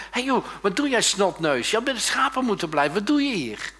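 high, loud big-brother telling-off; indignant eldest brother; scolding older brother. Acted. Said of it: "vooral die oudste, die zegt tegen hem".

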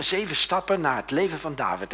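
ordinary talk, nothing marked

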